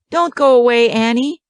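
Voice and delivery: put-on high, mournful little child. A narrator's reading. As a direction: plead like a sad little child. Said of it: "says the smallest child mournfully".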